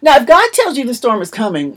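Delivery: ordinary delivery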